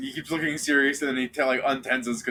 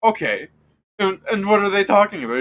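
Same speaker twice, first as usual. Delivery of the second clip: guard voice; 7 semitones higher, 5 dB louder than usual. Acted. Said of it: "Mutton Chops there is going".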